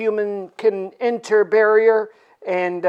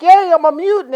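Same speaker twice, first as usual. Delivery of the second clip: goofy cheer; 9 semitones higher, 7 dB louder than usual. Retold or imitated. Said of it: "And he's like".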